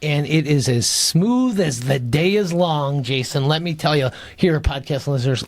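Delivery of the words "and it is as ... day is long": slow, relishing beer description; slow savouring plug. Acted. Host describes the sponsor's beer to listeners, slow and relishing. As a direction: linger over every word like a fine beer.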